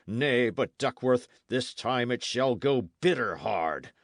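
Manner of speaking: loud, high-pitched vow of vengeance; rage and grief. A narrator's reading. Knight swearing vengeance for his slain men, furious. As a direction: swear revenge in mounting fury, hammering each phrase. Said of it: "convulsed with rage and grief".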